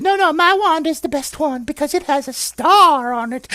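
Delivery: very high-pitched boasting fairy; high little fairy voice. Acted. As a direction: squeak it out like a contradicting fairy. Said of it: "And the fairy said".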